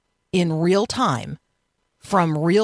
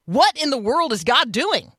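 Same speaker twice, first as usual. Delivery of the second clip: higher, bewildered complaint; frustrated, baffled question; exasperated; 6 semitones higher than usual. Shown as a demonstration. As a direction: throw up your hands at a hard situation. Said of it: "be like".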